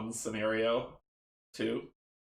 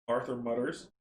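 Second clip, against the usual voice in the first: normal talking voice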